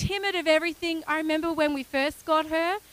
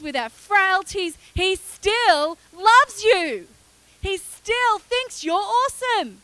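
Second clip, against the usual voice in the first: preaching; 3 semitones higher, 4 dB louder than usual. Acted. Preaching to the congregation.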